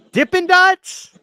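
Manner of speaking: delighted disbelief, high and drawn out; slow, high amazed gasp of a question. Real, not acted. Sees ice cream stand, amazed.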